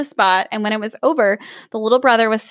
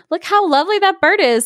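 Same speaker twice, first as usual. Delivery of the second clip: delighted; 8 semitones higher than usual. Acted. Delighted father speaks.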